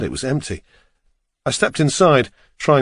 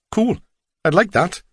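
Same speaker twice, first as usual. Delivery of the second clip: higher Welsh cabbie voice; Welsh accent; 7 semitones higher than usual. A narrator's reading. Driver agrees to read passenger's book, Welsh accent. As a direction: accept with a Welsh lilt. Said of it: "his strong Welsh accent".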